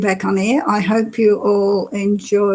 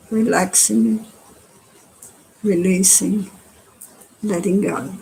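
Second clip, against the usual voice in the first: unhurried meditation guide with long pauses; guided meditation. Acted. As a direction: guide them gently through relaxing, phrase by phrase. Leads a body-scan meditation.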